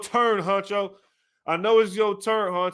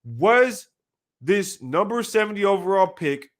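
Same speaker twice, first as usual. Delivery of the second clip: ordinary talk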